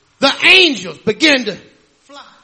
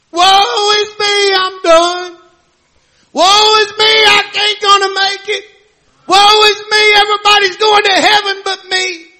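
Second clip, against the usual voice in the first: higher, whiny self-pitying woe; mock self-pity; 7 semitones higher, 4 dB louder than usual. Mocking churchgoers who whine about themselves, in a high self-pitying voice. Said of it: "He wasn't saying, "Woe is me," like some of us".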